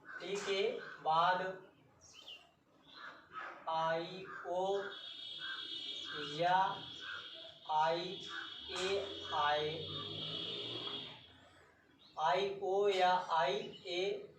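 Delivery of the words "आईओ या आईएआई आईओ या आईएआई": slow, drawn-out letter names आईओ and IAI; spelling slowly. Shown as a demonstration. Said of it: "spells out letters slowly".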